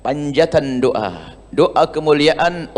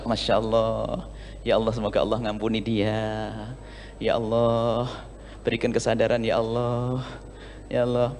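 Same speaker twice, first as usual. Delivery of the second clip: hushed, much softer, gentle forgiving sigh of a prayer; 5 semitones lower, 11 dB quieter than usual. Pretend. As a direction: murmur it like a girl forgiving the one who wronged her. Forgiving girl prays softly.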